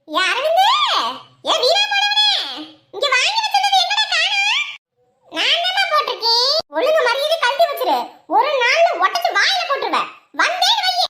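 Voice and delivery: high-pitched angry yelling; angry scolding. Acted. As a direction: yell the threat high and hard. Scolding a boy over misplaced thing.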